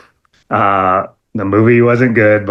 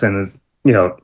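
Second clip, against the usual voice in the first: normal talking voice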